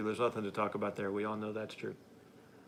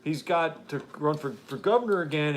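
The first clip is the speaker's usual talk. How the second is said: ordinary delivery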